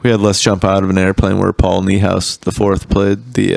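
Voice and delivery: usual speaking voice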